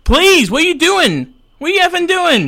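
loud, high-pitched pleading protest; exasperated yelp; exasperated outburst. Pretend. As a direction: burst out in high, loud disbelief. Mock exasperation at caller's offer.

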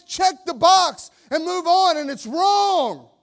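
loud, high preaching that builds to a roar; full-force preaching. Acted. Pastor preaching hard to his congregation, loud and high. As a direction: preach it out to the whole church.

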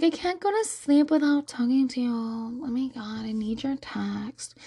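mock a clingy girl, fading to a whisper; mocked other girl. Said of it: "another girl that was like".

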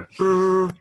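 high, loud trilled trumpet noise; trumpet fanfare imitation. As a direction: trill a drawn-out brassy reveal sting. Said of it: "it's like a trumpet sound".

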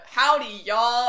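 put-on loud cowboy Texan accent; mock Southern drawl, loud. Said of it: "trying to talk in, like, the".

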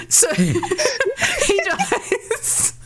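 high, laughing through the words; cracking up while telling it. Real, not acted.

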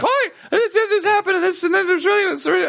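very high, frantic babble; imitated frantic lady. Frantic lady talking to three cops, imitated panic. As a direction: mimic a flustered woman tripping over her words. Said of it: "one frantic, like, Chinese lady".

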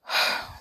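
long tired sigh; deep sigh. Acted. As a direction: sigh heavily. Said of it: "The man sighed deeply".

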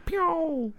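high whistling pew; zing it like a ricochet; bullet ricochet. Shown as a demonstration. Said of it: "it makes a ricochet sound".

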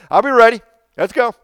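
excited. Retold or imitated. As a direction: play him all fired up and ready to go. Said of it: "He was excited".

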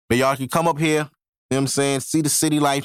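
usual speaking voice

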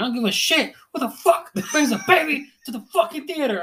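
half-laughing replay of a furious outburst; retold rant. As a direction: go off on them again, laughing through it. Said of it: "I was going off".

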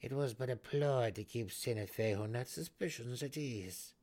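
loud, imperious matriarch; cold matron voice. A narrator's reading. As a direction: play the haughty Matron. Malice speaking.

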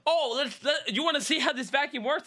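high, sugary salesman pitch; pushy vacuum salesman. Retold or imitated. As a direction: ham up a nineties door-to-door seller. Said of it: "they'd be like".

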